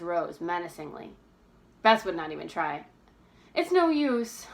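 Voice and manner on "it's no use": sighing. A narrator's reading. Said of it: "she sighed".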